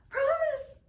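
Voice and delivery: very high, loud squawk; baby raven cry. A narrator's reading. Baby Raven eagerly promises Sky Chief, squawking high. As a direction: shriek it like an eager baby bird. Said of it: "cried Baby Raven".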